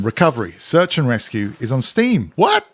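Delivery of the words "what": high, loud yelp of disbelief; astonished. Real, not acted. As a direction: pitch shoots right up in surprise. Reads surprising news about a game.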